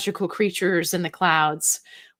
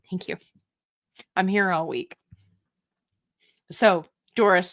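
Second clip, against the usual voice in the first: ordinary talk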